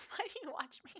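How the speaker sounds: high-pitched, giggly and helpless; laughing. Real, not acted. Streamer asking viewers why they watch, laughing hard. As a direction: question breaking into loud laughter.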